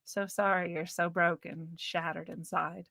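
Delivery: drip fake pity; sarcastic apology.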